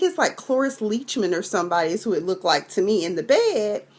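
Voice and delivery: ordinary talk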